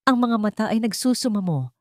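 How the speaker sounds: plain reading voice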